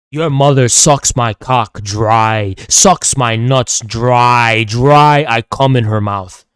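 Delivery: low, slow and loud, dragging out every word; crude taunt. Acted. Streamer insulting a viewer's mother, low slow mocking drawl. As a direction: lean into the insult slowly and heavily.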